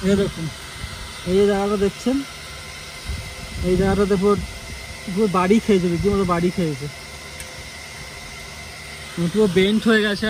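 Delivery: hushed close-up commentary; quiet. Real, not acted. Crouched by bike, speaking low. (নিচু গলায় বলা)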